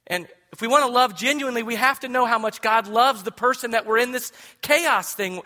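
loud, high, fast pulpit build; escalating sermon climax; preaching at full force. Real, not acted. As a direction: drive it home with rising urgency.

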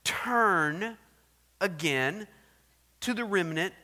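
loud, booming scripture reading; preaching scripture. Acted. Preacher reading the king's letter to the church, booming.

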